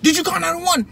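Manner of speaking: high-pitched, heated demand; retold angry cop. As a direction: imitate a cop pressing the question harder. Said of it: "starts cussing at me".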